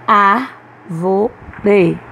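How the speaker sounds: "Árvore" in three separate syllables, strong Ár; stressed syllable demo. Shown as a demonstration. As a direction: break up "Árvore", leaning on the first syllable. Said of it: "há uma sílaba pronunciada com mais força". ("Árvore" silabado, com força em Ár)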